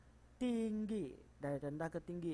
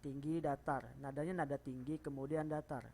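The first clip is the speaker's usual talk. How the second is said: ordinary delivery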